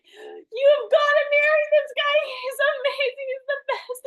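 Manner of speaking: very high, loud crying voice; hammed-up overjoyed wail; imitated tearful gushing. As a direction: gush through mock sobs. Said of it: "She literally starts crying".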